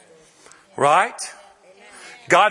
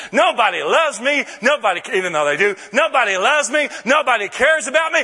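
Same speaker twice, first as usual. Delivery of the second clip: with great confidence; 7 semitones higher than usual. Shown as a demonstration. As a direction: declare it proudly, like good news. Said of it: "I say it with great confidence".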